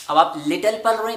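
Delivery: usual speaking voice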